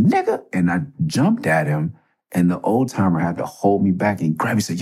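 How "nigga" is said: defiant retort. Retold or imitated. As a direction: throw the word back at him. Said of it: "And I said".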